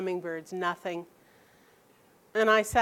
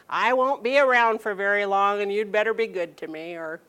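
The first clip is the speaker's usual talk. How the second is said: grandparent guilt trip; 4 dB louder than usual. Retold or imitated. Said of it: "maybe you have some grandparents now are going".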